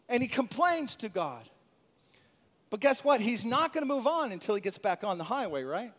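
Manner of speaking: ordinary talk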